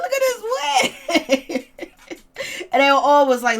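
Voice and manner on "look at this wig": mocking laugh. Mocking the wig reveal.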